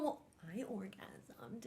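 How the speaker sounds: hushed, slow whisper; mock whispered boast. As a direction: retell the boast in a drawn-out whisper. Retold one-upping boast, whispered.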